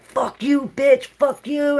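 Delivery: high, mock-snarling curse; mean dream self. Telling viewers how she cursed in her dream, mock mean and high. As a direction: hurl it at them, playing her nasty dream self. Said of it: "I was being such a bitch".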